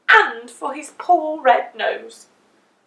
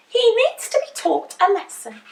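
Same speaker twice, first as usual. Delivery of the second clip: higher, louder indignant voice; Missus Washer; 9 semitones higher, 4 dB louder than usual. A narrator's reading. The laundry woman demanding a lesson, raised voice. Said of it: "said Missus Washer".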